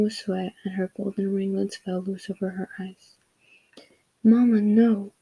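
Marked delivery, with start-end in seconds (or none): 4.2-5.1 a lightly raised, pleading cry. A narrator's reading. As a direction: call out to stop mother. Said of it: "cried Jonas".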